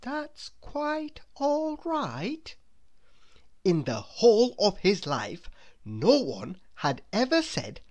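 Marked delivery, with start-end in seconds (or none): 0.0-2.5 high, slow, much softer wonderment; repeating to himself. A narrator's reading. Mister Good repeating the kind words softly to himself. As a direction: murmur it back in disbelief. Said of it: "repeated Mister Good to himself".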